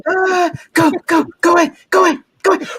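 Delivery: high, mock-scared cries; hammed-up fright. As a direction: shriek at a pretend ghost. Pretend fright at ghost.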